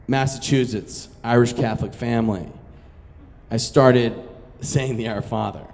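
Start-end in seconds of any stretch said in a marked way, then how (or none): none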